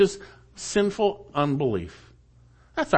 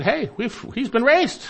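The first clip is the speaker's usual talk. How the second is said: excited news; 10 semitones higher than usual. Retold or imitated. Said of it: "Even the women had said".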